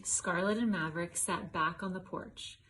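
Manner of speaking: ordinary delivery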